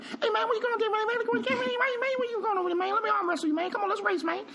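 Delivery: very high squeaky excited voice; high-pitched hyper uncle, squealing every man; Cat Williams impression. Impersonating his uncle Bennett as Cat Williams, very high. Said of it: "That's my Cat Williams impression".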